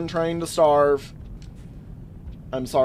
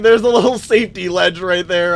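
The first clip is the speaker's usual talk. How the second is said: excited; 3 semitones higher, 8 dB louder than usual. Retold or imitated. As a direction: blurt it out thrilled. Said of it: "I'm like".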